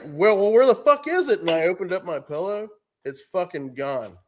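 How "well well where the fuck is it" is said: high, loud demanding wife; wife loudly demands to see the spider. Retold or imitated. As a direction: demand an answer, loud and pitched up.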